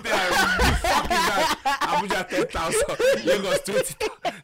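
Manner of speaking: high, loud, slow, laughing impression; hammy auditor voice, cracking up; laughing mock auditor voice.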